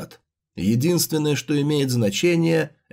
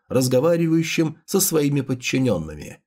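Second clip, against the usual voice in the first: usual speaking voice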